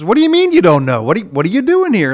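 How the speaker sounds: a baffled angel losing patience; exasperated angel. Retold or imitated.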